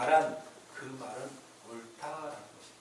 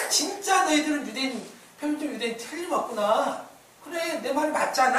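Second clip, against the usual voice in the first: higher, louder voiced retort; mock vindication; 10 semitones higher, 6 dB louder than usual. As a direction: raise pitch and volume as if proven right. Paul's imagined retort, voiced by preacher. (높고 크게, 거 보라는 듯이)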